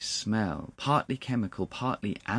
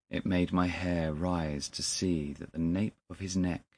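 ordinary delivery

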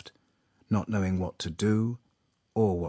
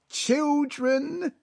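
high-pitched, drawn-out tearful address; emotional father. A narrator's reading. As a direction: address the young couple, overcome. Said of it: "he muttered".

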